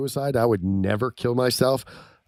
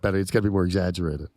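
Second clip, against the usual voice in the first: usual speaking voice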